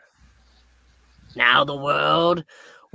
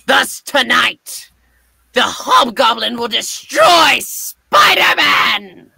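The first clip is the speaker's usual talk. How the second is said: building villain declaration; 7 semitones higher, 5 dB louder than usual. Acted. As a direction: build to a triumphant vow of destruction. Villain vows to defeat Spider-Man.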